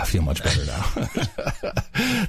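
slow, laughing with relief; chuckling it off after a rant; relieved laugh. Real, not acted. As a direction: let the anger melt into a laugh.

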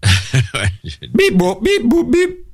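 rising robotic beeps. Pretend. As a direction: beep like a glitching robot. Robot bit again.

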